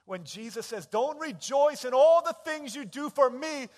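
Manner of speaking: high, loud sermon climax building to a sustained shout; full-force preaching. Real, not acted. Preacher quoting Jesus to the congregation, shouted proclamation. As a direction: proclaim it at the top of your voice.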